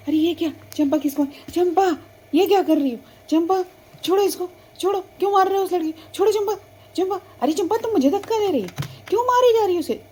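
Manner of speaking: loud, high alarmed scolding; shouting at the cow to let go. Acted. Older sister scolding the cow. (ऊँची आवाज़ में गाय को डाँटना)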